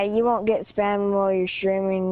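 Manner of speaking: ordinary talk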